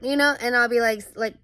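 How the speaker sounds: normal talking voice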